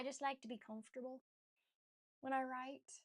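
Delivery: whispered aside. Real, not acted. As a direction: drop to a whisper at the end. Trails into whisper.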